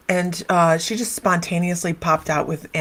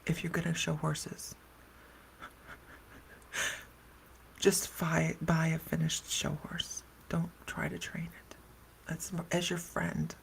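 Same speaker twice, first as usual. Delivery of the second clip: hushed warning; 12 dB quieter, slower than usual. Retold or imitated. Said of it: "And she goes".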